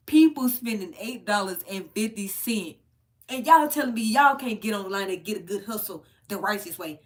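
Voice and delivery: loud disbelieving rant at buyers; heated rant. Real, not acted. Ranting at content creator's buyers.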